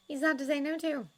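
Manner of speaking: sympathise gently with him; understanding Miss Hooper. A narrator's reading. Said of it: "she said understandably".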